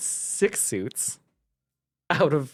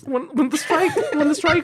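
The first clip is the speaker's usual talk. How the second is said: giggling through the words; laughing; 10 semitones higher, 3 dB louder than usual. Real, not acted. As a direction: laugh as you stumble over it. Laughing while stammering.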